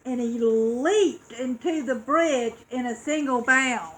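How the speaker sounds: ordinary delivery, nothing marked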